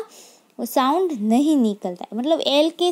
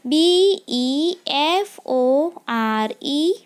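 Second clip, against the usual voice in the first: each letter spaced out slowly; slow dictation of letters; slow spelling; much slower than usual. Shown as a demonstration. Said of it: "teacher spells word for class".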